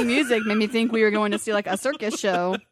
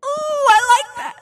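excited squeal; 11 dB louder, slower than usual. Real, not acted. Reacting to her Vader voice.

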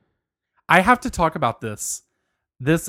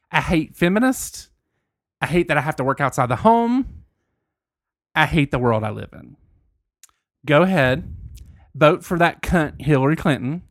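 country voice. Acted. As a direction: read it as a fed-up country ranter. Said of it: "I'm gonna say this in my country voice".

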